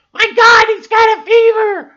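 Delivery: very high, loud hammed-up alarm; over-the-top horrified cry; mock horrified shriek. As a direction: scream it like a panicked bystander.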